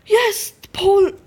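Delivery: sharp gasp into a high, loud cheer; excited yelp. Real, not acted. Player spotting the royal jelly, loud thrilled cheer. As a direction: squeal with triumph.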